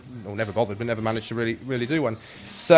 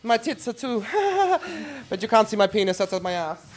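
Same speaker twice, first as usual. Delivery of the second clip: put-on bawdy Trixie in an affected high accent; in-character accent; 8 semitones higher than usual. Trixie trading crude jokes with the interviewer, high put-on accent. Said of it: "I don't know why I'm doing this accent".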